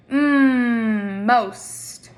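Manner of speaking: "Mm" hummed loud, then "most"; teacher isolates the first sound. Shown as a demonstration. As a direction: hum the "Mm" before saying "most". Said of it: "What words start with mm?".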